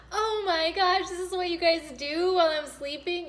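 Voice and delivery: put-on high, loud, thrilled child's gasp; excited little girl. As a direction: gush like a kid who caught her parents.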